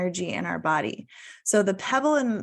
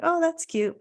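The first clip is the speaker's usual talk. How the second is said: dismissive; 9 semitones higher than usual. Retold or imitated. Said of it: "at that time I was like".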